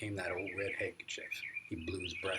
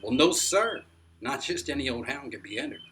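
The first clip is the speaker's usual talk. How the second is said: higher, louder eager answer; excited burst; 5 semitones higher, 11 dB louder than usual. A narrator's reading. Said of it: "Grandpa almost jumped as he answered".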